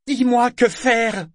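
high, slow, loud call; shouted plea. A narrator's reading. Boy calling to the liveship for guidance, shouting. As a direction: holler it the length of the ship. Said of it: "Il inspira profondément et cria".

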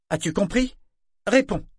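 loud, high, peremptory questioning; ton sec. A narrator's reading. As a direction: demand an answer sharply. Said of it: "fit Kefria d'un ton sec". (mère qui exige sèchement une réponse)

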